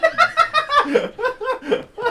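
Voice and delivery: high squealing laugh. Real, not acted. Player after botching a French accent, squealing laugh.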